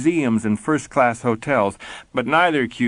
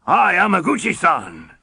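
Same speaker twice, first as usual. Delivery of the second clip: higher, playful fake Japanese accent; 7 semitones higher, 3 dB louder than usual. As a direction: tease him with a put-on Japanese accent. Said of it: "in a fake Japanese accent".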